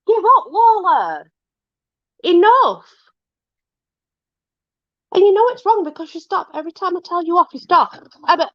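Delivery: sharp high telling-off; high scolding. Real, not acted. Telling off Lola for gagging, high and scolding.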